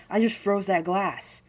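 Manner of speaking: high, loud, drawn-out boast; excited child. Retold or imitated. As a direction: announce it like a thrilled little kid. Acting child proudly telling mom.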